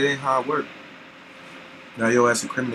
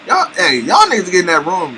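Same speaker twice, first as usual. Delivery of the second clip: calling out; 6 semitones higher, 6 dB louder than usual. Real, not acted. Calling out to the room.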